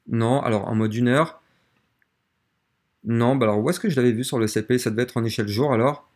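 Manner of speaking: low, soft talk to oneself while searching; quietly hunt for the right chart, half to yourself; thinking aloud. Real, not acted. Cherche le graphique, se parle à lui-même. (se parle à lui-même, à voix basse)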